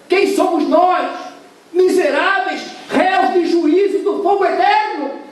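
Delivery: high, loud, slow fiery preaching; preaching at full force. Acted. Preacher cries to the congregation that they are wretched sinners, fiery and loud. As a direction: thunder it at the congregation.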